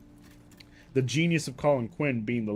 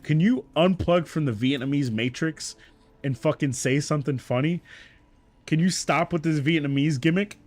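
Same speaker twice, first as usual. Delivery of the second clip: louder, raised-pitch imitation of a blunt call-out; exasperated heckle, acted out; raw call-out; 4 semitones higher, 5 dB louder than usual. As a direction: call him out bluntly, as a retold quote. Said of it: "Patrice was fucking raw".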